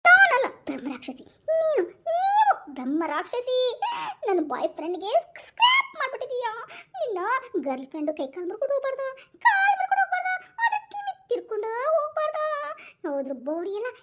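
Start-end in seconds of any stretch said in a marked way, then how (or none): 0.0-6.5 half laughing, half crying comeback; hammed-up teary protest, louder; mock wailing retort. 9.4-12.4 higher, louder spiteful curse; much higher shrieking curse; shrieked curse. Acted. Cursing the girlfriend.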